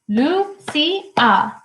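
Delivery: Lucia in three held beats, Lu... ci... a; syllable by syllable. Shown as a demonstration. Teacher helping the class count syllables, syllable by syllable. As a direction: split Lucia up, starting high and stepping down. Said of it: "Does it have two syllables".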